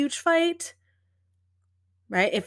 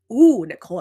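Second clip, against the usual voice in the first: delighted reaction; 10 dB louder than usual. Retold or imitated. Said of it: "And I loved that".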